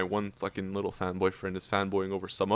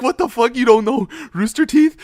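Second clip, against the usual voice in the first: loud, high mock astonishment; retold incredulous outburst; 13 semitones higher, 11 dB louder than usual. Hardcore fan to clueless buddy, mock incredulous outburst. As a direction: imitate a fan who cannot believe it. Retells friend's stunned disbelief.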